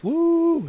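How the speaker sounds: high, loud, drawn-out hoot; monkey-like woo. Retold or imitated. Showing we are primates, high monkey whoop.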